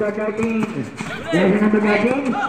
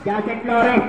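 ordinary delivery

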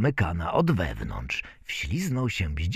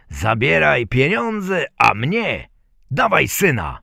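loud, raised-pitch order; commanding gentleman; 6 semitones higher, 7 dB louder than usual. A narrator's reading. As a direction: close the deal forcefully. Gentleman closes the deal. (rozkazujący pan, głośno)

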